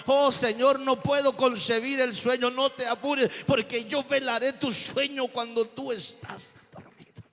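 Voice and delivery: loud, high, impassioned comfort; preaching at full force. Acted. Preacher voicing God's comfort to the grieving, loud and impassioned.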